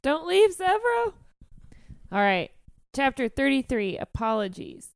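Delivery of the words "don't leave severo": pleading cry after him, raised; mock plea. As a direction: call out as if he could hear. Calling after character.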